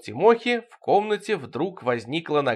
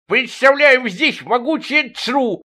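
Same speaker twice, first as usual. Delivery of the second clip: very high, loud, slow squeal; drawn-out comic shriek of self-importance; squealing diver; 11 semitones higher, 6 dB louder, slower than usual. A narrator's reading. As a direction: squeal it out slowly, boasting. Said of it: "завизжал водолаз".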